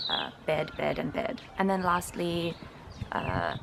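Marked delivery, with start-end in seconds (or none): 0.5-1.4 "bed, bed, and bed" all with one identical "e"; merged vowel. Shown as a demonstration. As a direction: say bed, bird and bad all as "bed". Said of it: "all be pronounced the exact same way".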